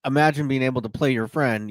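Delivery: ordinary delivery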